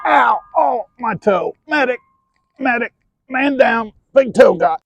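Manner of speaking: loud high yelps of pain; yelping in pain. Real, not acted. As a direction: yell out, toe just got hit. Bar dropped on his toe.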